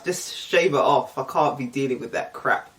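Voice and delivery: ordinary delivery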